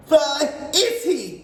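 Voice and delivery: high, loud, slow snap; witch snapping. A narrator's reading. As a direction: demand it sharply. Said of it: "snapped the Grand High Witch".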